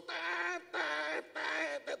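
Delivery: high imitated car horn tet tet; horn beeps. Mimicking the car honking at him.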